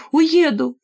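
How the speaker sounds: put-on girl screaming she will leave; shouted cry. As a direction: yell Уеду as she breaks away. Repeated cry, louder.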